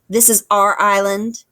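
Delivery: loud, menacing snarl. A narrator's reading. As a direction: claim the island with a threat. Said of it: "snarled Nettle".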